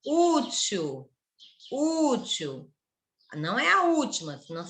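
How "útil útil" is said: "útil" slow with a long first U; drawn-out U in "útil"; stress on U. Shown as a demonstration. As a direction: stretch the opening vowel of "útil", short til. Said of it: "A sílaba tônica é a penúltima".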